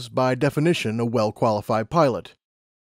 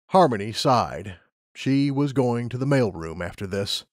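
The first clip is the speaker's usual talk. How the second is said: ordinary delivery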